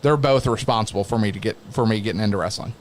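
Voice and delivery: ordinary delivery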